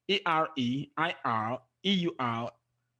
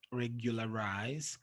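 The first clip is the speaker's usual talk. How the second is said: regularize low and soft, rising at the end; quiet repeat; 5 semitones lower, 7 dB quieter than usual. Shown as a demonstration. Teacher repeating an exception word, regularize softly and lower. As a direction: drop the voice and repeat regularize lightly.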